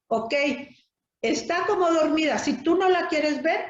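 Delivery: ordinary talk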